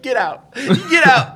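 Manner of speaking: mock-dramatic order rising to a high yell; imitated upset wife. Husband imitating wife throwing him out, escalating mock outrage. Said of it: "literally tearing up".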